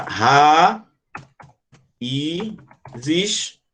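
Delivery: "ra, i, zis" with a clear pause after each piece; separated syllables. Shown as a demonstration. Teacher showing the I stands alone, "ra, i, zis" syllable by syllable. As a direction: isolate the "i" between "ra" and "zis". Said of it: "O I aqui vai estar sozinho".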